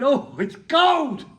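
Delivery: high, slow, loud shivering penguin; frozen penguin. Acted. Comedian voicing a freezing penguin to the crowd. Penguin voiced in comic story.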